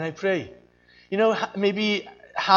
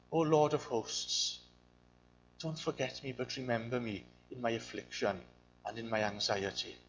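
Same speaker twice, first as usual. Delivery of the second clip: humble quiet plea; 7 semitones lower, 10 dB quieter than usual. Retold or imitated. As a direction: pray it hushed and humble. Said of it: "When Hannah comes".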